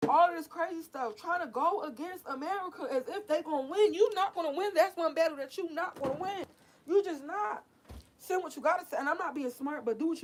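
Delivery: heated rising rant. Real, not acted. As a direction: climb in pitch as the anger grows.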